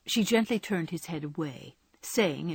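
plain reading voice

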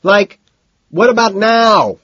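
high, loud impatient yell; exasperated shout; scream. A narrator's reading. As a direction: demand it at the top of your voice. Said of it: "Price screams".

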